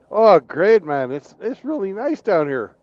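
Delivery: cheerful Canadians in hell. Acted. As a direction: play a happy hoser thanking the devil. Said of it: "they're like".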